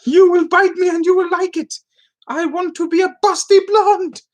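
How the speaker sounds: high, loud hammed-up command; mock yelling. Streamer ordering the cat to bite, mock yelling. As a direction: insist like a desperate madman, in jest. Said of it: "I'm yelling at a cat".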